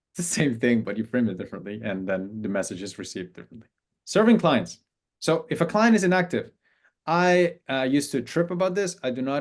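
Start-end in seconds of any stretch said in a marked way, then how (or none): none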